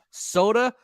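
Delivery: usual speaking voice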